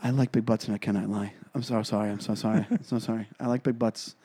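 low, hushed mock condolence; very low, soft funeral voice; whisper voice. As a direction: murmur it solemnly, like sympathy at a wake. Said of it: "say anything in that whisper voice".

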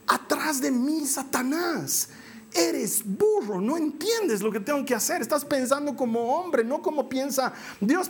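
a hint of stern Jesus; Jesus rebuking. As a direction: firmly set him straight.

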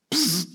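high quick psst sound; imitated instant-miracle hiss; magic zap hiss. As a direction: make a little magic zap.

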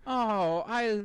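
lightly voiced wistful mother; mom impression. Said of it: "I love your impression of your mom".

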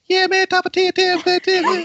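very high, fast, slurry Creole voice with a lisp; squeaky high impression of a Creole friend; high-pitched Creole buddy. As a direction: mimic a squeaky friend mumbling. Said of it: "he got a high pitched voice".

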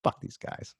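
unvoiced whisper; whispered curse. Siding against the cops, whispered swearing. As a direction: spit it under your breath. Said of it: "I'd be like".

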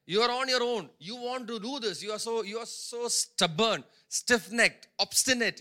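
loud, driving sermon warning; preaching at full force. Acted. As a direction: hammer home the stubborn prophet's warning.

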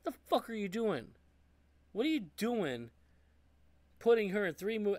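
high-pitched incredulous scolding; exasperated rant. Real, not acted.

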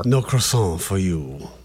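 slow, hammy Soup Nazi French; mock French accent. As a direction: deliver it like a snooty French chef. Mocking French, Soup Nazi style.

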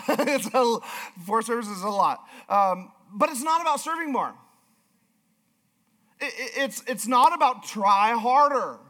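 impassioned preaching. Real, not acted. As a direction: press each point home in a high, emphatic voice. Preaches at full force.

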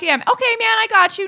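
breezy reassurance. Retold or imitated. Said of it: "I'm like".

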